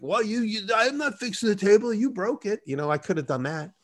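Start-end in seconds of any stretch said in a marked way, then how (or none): 0.0-2.6 high, whiny, put-on complaining voice; mock complaining. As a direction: whine like a petty customer. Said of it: "a complainy bitch and being like".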